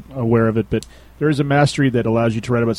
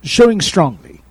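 higher, louder mock British accent; bad British accent; 5 semitones higher, 5 dB louder, slower than usual. Host mimics how they say it in the UK, bad British accent. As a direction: put on a terrible UK accent. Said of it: "That's my worst accent ever".

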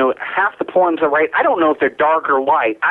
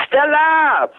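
put-on high, stretched Southern-accented call; shouted cry; 10 semitones higher than usual. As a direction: cry the name out to the heavens, theatrically.

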